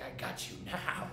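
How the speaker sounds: soft, unvoiced gloating; whispered menace. Holding the snapping little dog, whispered gloating. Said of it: "I was like".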